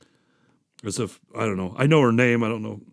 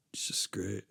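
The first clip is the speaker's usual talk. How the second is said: whisper; 6 semitones lower, 17 dB quieter than usual. Real, not acted. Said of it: "whispered aside".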